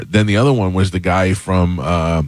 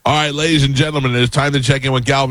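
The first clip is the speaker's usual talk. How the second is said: higher-pitched segment announcement; show host intro; 6 semitones higher than usual. Real, not acted. Host introduces colleague's open letter segment, show announcer. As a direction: announce the next bit to the crowd.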